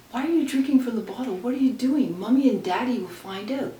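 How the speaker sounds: put-on loud, fast, anxious kid's plea; worried shout. Little sister shouting at her sister drinking from the bottle, alarmed.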